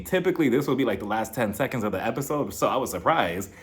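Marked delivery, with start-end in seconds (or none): none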